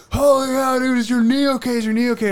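high, loud mock yell of worried bystanders; panicked shout. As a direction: shout it like strangers rushing over in alarm. Crowd runs up, retold.

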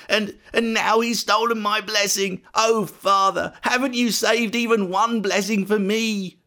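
high-pitched, aggrieved wail; acted hurt, cheated son; anguished lament. A narrator's reading. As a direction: protest bitterly to your father, slowing on key words. Esau laments being cheated.

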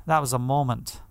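ordinary delivery

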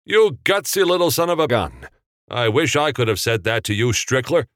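high-pitched gleeful congratulation; delighted praise. A narrator's reading. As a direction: cheer the youngster on with relish. Chortling, praising the gutsy youth.

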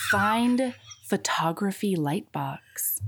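slow, whispered sultry read; breathy sexy whisper. Shown as a demonstration. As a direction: purr the list like a secret. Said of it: "Do the voice with your grocery list".

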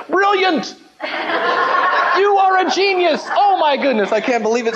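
high, laughing burst of wonder; boy thrilled by ketchup on fries, high and laughing; amazed delight. Retold or imitated. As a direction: gush like a kid who just tasted magic. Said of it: "and I was like".

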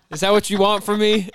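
loud, high playful protest; joking exasperation. Real, not acted. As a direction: raise your voice in mock exasperation, about to laugh. Joking protest about praising girlfriend.

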